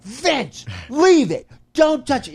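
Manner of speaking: high, loud exasperated command; retold angry outburst. Snapping at a friend kicking the wood, angry. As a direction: lose your temper at a friend, pitch leaping way up. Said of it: "I got so angry with you".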